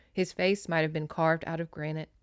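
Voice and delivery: ordinary talk, nothing marked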